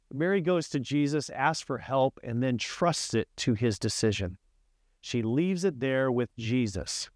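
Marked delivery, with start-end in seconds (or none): none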